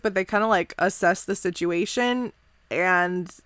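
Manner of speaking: normal talking voice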